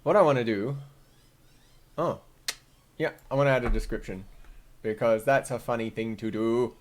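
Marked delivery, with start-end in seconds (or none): none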